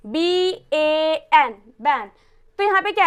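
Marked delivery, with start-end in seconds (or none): 0.1-1.5 बी ए एन spelled very slowly, letter by letter; slow letter-by-letter spelling of बी ए एन. Shown as a demonstration. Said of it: "जैसे कि बैन".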